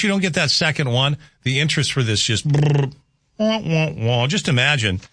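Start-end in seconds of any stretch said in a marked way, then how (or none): 2.5-4.3 mock deflating raspberry then droopy wah-wah-wah; hammed-up wah-wah let-down; sad trombone noise. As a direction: play the sad-trombone fail sound with your voice. Interest fizzles, sad trombone.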